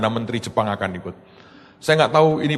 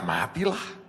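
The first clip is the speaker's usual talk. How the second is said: resigned aside; 10 dB quieter, slower than usual. Retold or imitated. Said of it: "kita berkata".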